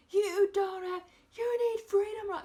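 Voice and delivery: loud, high, frantic yelling bum; imitating the screaming drunk. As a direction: shout it like an unhinged customer. Angry bum yelling at clerk.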